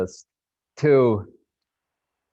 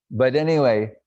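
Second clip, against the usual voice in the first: ordinary talk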